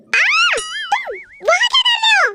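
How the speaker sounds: high-pitched shrieked question; yelp, then squeal in alarm; startled shriek. Acted. Girl spotting boyfriend behind Dad, startled shriek. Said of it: "ऐसे क्यों चिल्ला रहे हो?".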